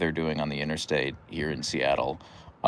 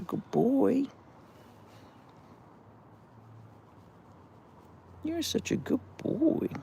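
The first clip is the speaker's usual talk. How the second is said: much higher, soft cooing voice; 11 semitones higher, 4 dB quieter than usual. Real, not acted. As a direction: coo gently while scratching the spot. Petting her dog.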